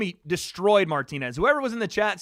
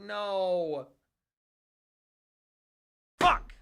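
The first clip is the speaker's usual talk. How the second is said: ordinary talk, nothing marked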